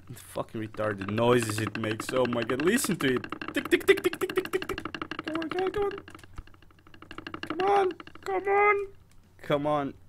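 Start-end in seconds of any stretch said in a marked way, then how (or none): 3.5-8.7 high ticking imitation. Imitating game noise high.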